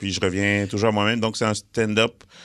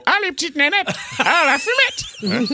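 very high, cartoonish French dubbing voice; Eddie Murphy. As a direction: squeal it high with stretched endings. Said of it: "Puis en français, il répond". (doublage français suraigu et caricatural)

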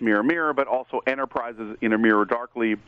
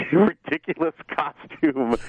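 laughing; 3 semitones higher, slower than usual. Real, not acted. Laughing at Tilly's costume.

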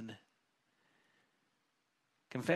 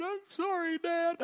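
put-on high little-girl voice, teary plea; imitating tearful daughter; 3 dB louder, slower than usual. Father retelling daughter's apology this week, high child voice. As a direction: sound like a child close to tears. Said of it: "Her chin began to quiver".

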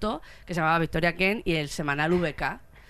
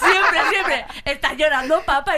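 higher, louder teasing through laughter; laughing teasing; 7 semitones higher, 7 dB louder, slower than usual. Retold or imitated. Teasing her crying dad, laughing, higher and louder. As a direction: tease dad while cracking up. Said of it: "le miraba en plan".

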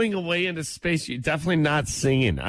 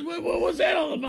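high silly voice. Retold or imitated. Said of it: "I thought".

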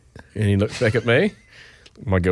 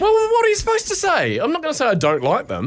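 high, protesting comeback; indignant self-defence; 14 semitones higher than usual. Real, not acted. Dad justifying his answer to co-host, indignant. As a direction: defend yourself against the teasing.

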